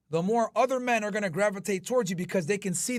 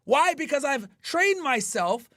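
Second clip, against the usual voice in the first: hyped rant; 8 semitones higher than usual. Real, not acted. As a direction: brag like a gym hype man. Ranting trash talk at rivals.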